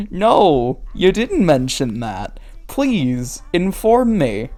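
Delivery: loud, swooping mock demand; playful, emphatic comeback; mock exasperation. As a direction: retort loudly with exaggerated interest. Mock retort to the diary writer.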